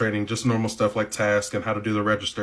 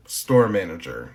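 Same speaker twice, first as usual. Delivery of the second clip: "Store manager" said half speed, lower and weighted; slow emphatic correction; 5 semitones lower, much slower than usual. Shown as a demonstration. Correcting herself to viewers, slow and low. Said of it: "then how the ... as the manager?".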